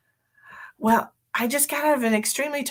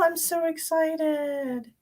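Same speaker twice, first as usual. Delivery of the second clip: mock excited drawl; 7 semitones higher, 3 dB quieter, much slower than usual.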